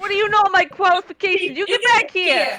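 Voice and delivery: high, quick indignant retort. Acted. Affronted townsman calling the little bird back, indignant.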